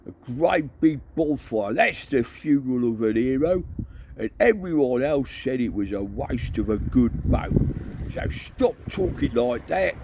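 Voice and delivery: rally the troops, ending in a shout; Cohen rallying. Acted. Cohen rallying the horde, rousing voice.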